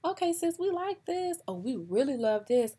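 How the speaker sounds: high, bubbly mock delight; gushing approval. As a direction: gush over it like a thrilled best friend. Acting out delight at an outfit.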